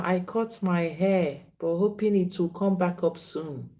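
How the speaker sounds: ordinary talk, nothing marked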